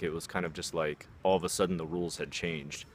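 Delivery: usual speaking voice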